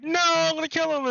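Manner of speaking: very high, agitated refusal, acted out; mock defiant threat. Acts out robber refusing, threatening.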